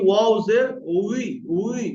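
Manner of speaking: ওয়াও জের উই slow, "উই" a rounded w-glide; rounded-lip wao. Shown as a demonstration. Teacher shows students the rounded opening, "উই" with unclosed lips. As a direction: round the lips without closing them on "উই". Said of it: "দুই ঠোঁট না মিলিয়ে গোল করে".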